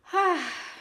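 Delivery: high, falling sigh. Real, not acted. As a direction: sigh over someone's foolishness. Sighs over Colin in recap.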